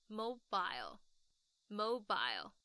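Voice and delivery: mobile drawn out, ending in a clear "bile"; 慢慢念英式mobile，尾巴发I的音; British pronunciation, very slow. Shown as a demonstration. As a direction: slowly sound out the long I at the end of mobile. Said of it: "尾巴要注意把那个I的音发出来".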